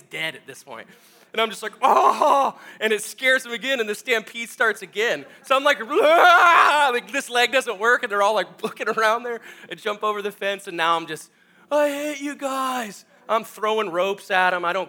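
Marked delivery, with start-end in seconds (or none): 1.8-2.6 loud, high pained "oh"; mock manly howl. Hired hand kicked in thigh, mock howl. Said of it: "I yelled out a very manly, uh, howl". 5.8-6.9 loud, high, long "aah"; mock terrified scream. Hired hand fleeing stampede on dead leg, mock scream. 11.7-13.0 high, slow, drawn-out wail; whiny mock despair. Hired hand throws ropes at horses, whiny wail.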